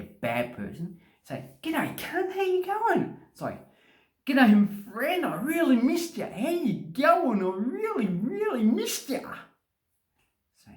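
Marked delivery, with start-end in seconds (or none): 1.6-3.2 very high, playful hello; playful affectionate greeting. Shown as a demonstration. Showing the rude word isn't mean, very high and affectionate. Shows it is not meant meanly. 4.3-9.2 very high, gushing greeting; gushing affection. Shown as a demonstration. Translating the rude greeting as friendly, high and gushing. Voices what the greeting means.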